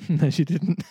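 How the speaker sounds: real laugh through the correction. Real, not acted. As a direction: correct yourself while cracking up. Laughs at own misreading.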